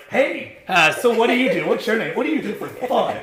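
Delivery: high, loud, bubbly put-on voice for the camera; fake cheerful small talk. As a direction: gush like a fake reality-show contestant. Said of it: "'cause I always be like this".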